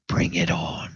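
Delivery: a low, taunting whisper; sneering whisper. Acted. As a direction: sneer it at Urgal under your breath. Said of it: "he kind of sneers".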